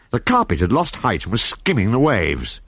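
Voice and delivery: ordinary delivery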